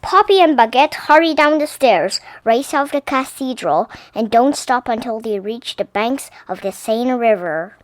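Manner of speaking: plain reading voice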